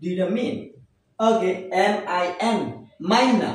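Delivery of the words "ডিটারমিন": ডিটারমিন ending in "min"; ডিটারমিন, no "mine" sound; correct pronunciation. Shown as a demonstration. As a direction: say ডিটারমিন with short i at the end. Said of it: "এর উচ্চারণ হবে".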